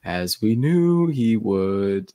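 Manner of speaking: slow, stretched sing-song; Jim Dale sing-song. Retold or imitated. Imitating Jim Dale as Hermione sighing about Harry, sing-song. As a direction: intone it slowly with a swooping lilt. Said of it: "like a singy, songy thing".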